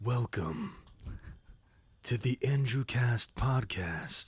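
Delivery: very slow, soft villain voice; drawn-out, hushed menace, word by word; Xemnas. Acted. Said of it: "are you Xemnas or are you Ansem".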